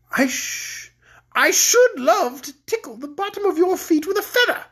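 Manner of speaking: high-pitched, gleefully menacing queen; cruel woman. A narrator's reading. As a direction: savour the threat of tickling. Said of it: "continued the cruel woman".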